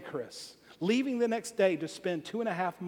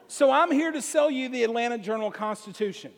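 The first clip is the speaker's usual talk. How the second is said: usual speaking voice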